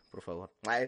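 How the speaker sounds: choking, struggling for breath; coughing on a swallow of water; choking on a drink. Real, not acted. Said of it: "Me ahogué, perdón".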